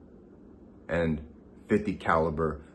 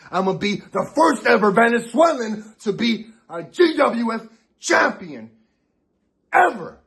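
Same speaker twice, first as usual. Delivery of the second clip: much higher, loud promo yell; fiery shout; 10 semitones higher, 3 dB louder than usual. Real, not acted. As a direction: peak the boast at the top. Wrestler's climactic promo boast.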